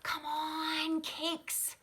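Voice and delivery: high, loud little bear cub; eager child squeal, not a whisper; Sam's excited voice. A narrator's reading. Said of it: "whispered Sam".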